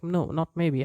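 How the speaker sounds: ordinary talk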